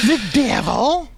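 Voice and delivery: high, drawn-out put-on voice; hammed-up, slow high reply; mock devilish reveal. Host suggesting the confession to mother-in-law, mock high drawl. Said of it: "You don't wanna be like".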